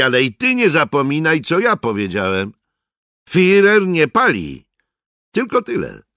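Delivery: high-pitched, insistent warning; defiant soldier. Acted. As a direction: stand up to the informer, pressing the point. Soldier warns informer in quarrel. (zaczepny, podniesiony głos żołnierza)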